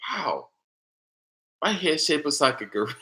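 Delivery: high, amazed voice at the mirror; amazed at himself. Retold or imitated. Man surprised by his reflection while brushing teeth, high and amazed. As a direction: gasp it out in wonder. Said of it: "I was like".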